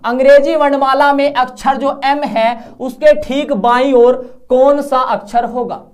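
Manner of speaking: slow, loud question reading. Acted. Teacher reads exam question three to the class, slow and loud. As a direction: read the question slowly, projecting every word.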